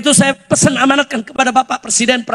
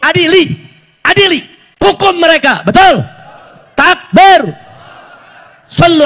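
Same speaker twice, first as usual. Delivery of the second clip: short, forceful bursts at raised pitch; rallying shout; 4 semitones higher, 6 dB louder than usual. Real, not acted. Preacher calling the crowd to demand punishment, rallying shout.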